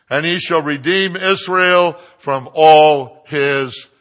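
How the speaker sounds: loud, slow, proclaimed verse; preaching scripture. Real, not acted. Preacher reading the psalm to the congregation, booming proclamation. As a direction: declaim it phrase by phrase, drawn out. Reads psalm at full force.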